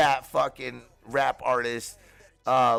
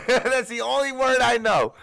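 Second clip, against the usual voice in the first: laughing it out, higher and louder; 10 semitones higher, 4 dB louder than usual. Real, not acted. Laughing at his one Creole word, giggling. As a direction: laugh through the line.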